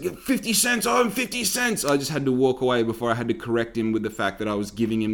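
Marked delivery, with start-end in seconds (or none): 0.0-1.8 lightly voiced clueless butcher, higher; a hint of a dopey shop worker, higher pitch; dim butcher voice. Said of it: "And he's just like".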